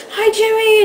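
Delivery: high, loud starstruck hello; excited fan greeting. Retold or imitated. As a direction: squeal his name. Fan greeting her idol.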